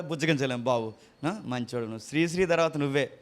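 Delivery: flatterer's mock praise; mock flattery. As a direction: coax with fake praise, low and soft. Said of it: "అనాలా?".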